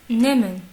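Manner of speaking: NEMEN as "né-men", no h, loud; emphatic model "Nemen"; silent H. Shown as a demonstration. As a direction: say Nemen with a long e and mute h. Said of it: "il ne se prononce pas".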